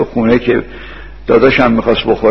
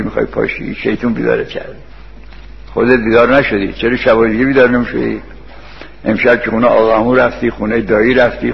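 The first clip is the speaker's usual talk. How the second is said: ordinary talk, nothing marked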